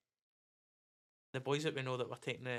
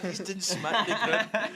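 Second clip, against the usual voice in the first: laughing; 6 semitones higher, 6 dB louder, much slower than usual. Real, not acted. Joking about drilling the keeper.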